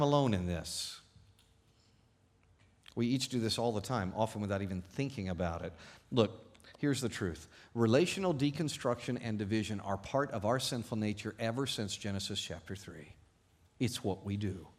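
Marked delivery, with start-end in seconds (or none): none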